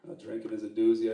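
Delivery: normal talking voice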